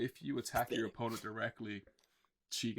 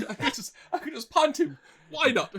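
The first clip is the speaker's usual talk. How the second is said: laughing; 10 dB louder than usual. Real, not acted. Laughing joke about punting Yugi.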